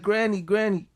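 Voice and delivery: high, loud calling out. Retold or imitated. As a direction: call for granny, loud and high. Kids calling for grandma.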